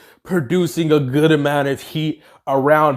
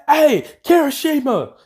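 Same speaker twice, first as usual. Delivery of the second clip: higher call across the fight; calling a teammate; 11 semitones higher than usual. Acted. Heroine calls Kirishima over, higher and bright. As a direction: call out to a friend mid-battle.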